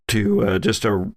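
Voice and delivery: ordinary talk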